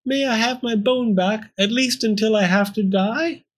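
high-pitched, pitiful plea; whimpering. A narrator's reading. Pearl asking for her bone before dying, whimpering. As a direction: beg in a high little voice. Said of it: "Pearl whimpered".

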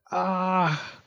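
high yelp of shock; cry of dismay. A narrator's reading. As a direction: cry out as the block vanishes. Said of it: "cried Square".

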